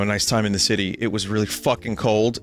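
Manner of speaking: ordinary talk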